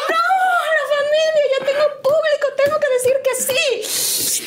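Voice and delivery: very high, hammed-up comic desperation; mock panicked wail. Woman seeing the family approach, high mock panic. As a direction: wail it high, half laughing.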